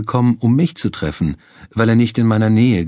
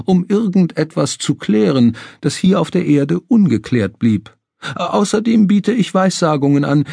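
theatrical medium; 8 semitones higher than usual. Acted. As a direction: pitch it like a stage psychic. Medium Mortimer voiced.

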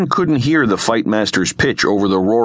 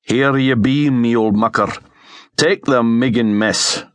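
broad Scots old comrade, put-on voice; Scots sidekick; 2 semitones higher than usual. Alex thrusts a package at Sten, gruff Scots banter. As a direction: play a Scottish sergeant handing over a chore. Alex's voice in the story.

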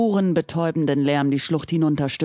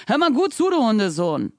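higher angry bark into the radio; 5 semitones higher than usual. A narrator's reading. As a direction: bark it out furiously. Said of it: "rief Wilmington jetzt erneut mit bellender Stimme".

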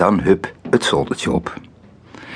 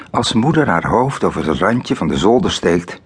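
usual speaking voice

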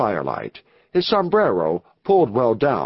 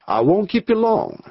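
higher-pitched character voice; unbothered visitor; 8 semitones higher than usual. A narrator's reading. Visitor answers the leader's complaint, higher-pitched voice.

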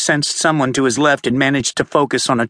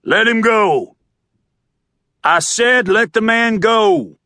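big cop ordering officers off; booming authority; 7 semitones higher, slower than usual. A narrator's reading. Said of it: "authoritative, booming voice".

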